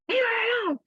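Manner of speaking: high protective yell; jumping out of the car to defend her; friend yelling. Retold or imitated. Best friend jumps out of the car, high protective yell. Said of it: "she jumps out the car".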